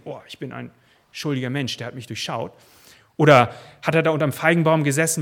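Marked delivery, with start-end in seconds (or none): 0.0-2.5 hushed guilty thought. Pretend. Guilty man's inner thought.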